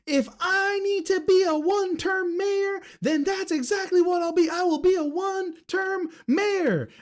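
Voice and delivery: very high, squeaky mock of the mayor. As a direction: parody her in a shrill falsetto. Mimicking the mayor's quote.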